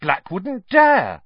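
slow, emphatic, high and loud; spirited insistence. A narrator's reading. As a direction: declare it with bold conviction. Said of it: "said Ron spiritedly".

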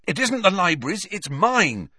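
quick, high-pitched defensive protest; hurried self-defence; hasty. A narrator's reading. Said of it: "said Harry hastily".

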